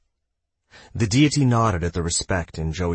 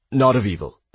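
louder, higher quick protest; exclaiming; 4 semitones higher, 5 dB louder than usual. Acted. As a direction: exclaim it to clear his name. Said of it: "he exclaimed".

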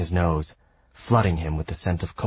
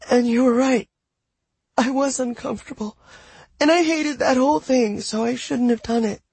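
a touch of a trembling high voice; high and shaky. Acted. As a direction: near tears, voice wobbling. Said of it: "her voice high and shaky".

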